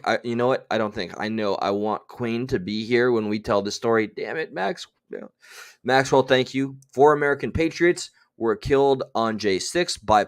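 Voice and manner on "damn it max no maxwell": ordinary talk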